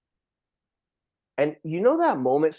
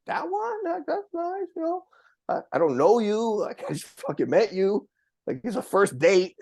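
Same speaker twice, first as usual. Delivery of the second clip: awkward reply; 7 semitones higher than usual. Retold or imitated. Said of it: "I'm like".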